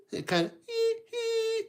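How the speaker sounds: high, thin hee hee squeak; a weak squeaky whistle; broken whistle. Shown as a demonstration. Showing kids the broken whistle, high thin squeak. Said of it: "Instead of hoo".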